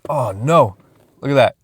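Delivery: normal talking voice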